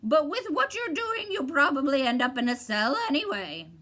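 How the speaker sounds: lightly voiced mocking bird; a hint of a squawky bird taunting. A narrator's reading. Bird teasing Milo, lightly voiced.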